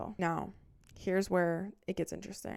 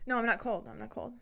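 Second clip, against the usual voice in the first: stubborn denial; 5 semitones higher than usual. Pretend. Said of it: "pretending like I'm freezing".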